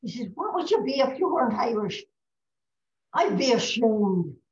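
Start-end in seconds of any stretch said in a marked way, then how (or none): 0.3-2.0 higher, louder Irish brogue; Irish pub drinker. Acted. Drinkers put a question to Paddy, lilting Irish brogue. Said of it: "This is an Irish pub we're in". 3.1-4.4 slow, loud Irish punchline; Irish pub drunk. Acted. Paddy delivers the joke's punchline, slow Irish brogue. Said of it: "they go over to where Paddy is sitting".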